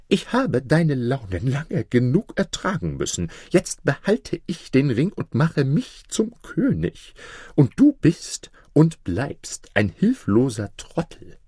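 high-pitched, contemptuous vizier; defiant scheming vizier. Acted. Vizier seizes power from the king, defiant.

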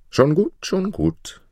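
put-on high, light boy's voice; dismissive boy. A narrator's reading. Boy answers the giant's orders, high and dismissive. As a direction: brush him off in a high boy's voice.

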